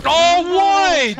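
loud, high cry of frustration; exasperated shout. Real, not acted. Player missing a jump, exasperated shout.

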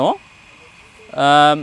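ordinary delivery

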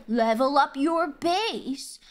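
slow, drawn-out game instructions; mocking game prompts. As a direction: recite it slowly, mocking a dull game. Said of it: "It's like".

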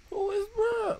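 baffled shout. Real, not acted. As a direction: shout it out, totally lost. Confused by the story twist.

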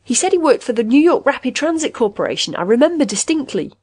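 high excited cry of sudden recall; sudden shout. A narrator's reading. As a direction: burst out loudly, pitched well up. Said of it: "Haskins suddenly shouted".

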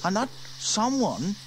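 put-on high, bright-eyed girl wondering aloud; young girl Sarah, curious. A narrator's reading.

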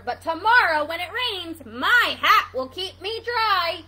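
loud, emphatic hedgehog comeback; indignant retort. Acted. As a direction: answer the mockery with force. Hedgehog answers the mocking gander.

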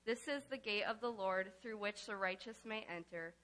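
ordinary talk, nothing marked

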